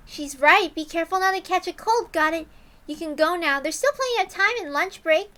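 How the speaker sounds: cheerful teacher. Acted. Teacher dismissing the girls to lunch. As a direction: send the kids off kindly.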